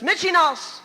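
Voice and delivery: mock motherly holler; mother's yell. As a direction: yell it out suddenly at the kids. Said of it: "my mother would yell to us".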